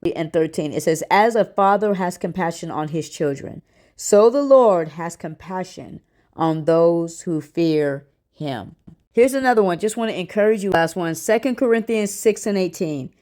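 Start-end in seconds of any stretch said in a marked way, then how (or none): 1.1-8.8 slow, measured Bible reading; scripture reading. Real, not acted. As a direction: read the verse slowly, sinking low at the end. Reads a Bible verse aloud.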